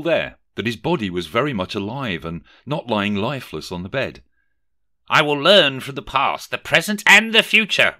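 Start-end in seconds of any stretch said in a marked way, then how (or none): 5.1-8.0 high recital building to a loud finish; repeated to himself. A narrator's reading. Scrooge reminding himself of his vow, energised. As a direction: repeat the vow, lifting higher on each item. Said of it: "Rishi repeated to himself".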